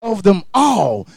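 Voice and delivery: ordinary delivery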